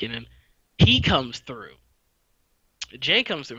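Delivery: ordinary delivery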